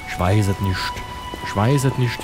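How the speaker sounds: ordinary talk, nothing marked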